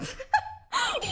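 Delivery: high burst of laughter. Real, not acted. Laughs at own voice.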